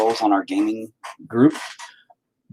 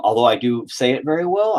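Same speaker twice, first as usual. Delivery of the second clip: ordinary talk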